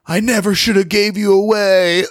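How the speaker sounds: high, hammed-up movie plea; acted mother's regret. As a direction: wail the line as a mock-tragic mother. Acting the mother's line from film.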